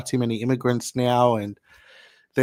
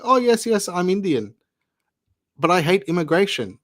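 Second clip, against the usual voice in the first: imitating an Indian accent; 6 semitones higher, 3 dB louder than usual. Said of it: "'Cause you sound Indian".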